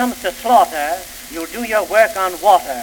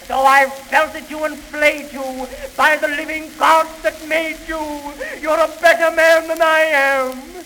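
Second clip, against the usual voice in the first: impassioned shout; 8 semitones higher than usual. Acted. Climactic tribute, rising passion.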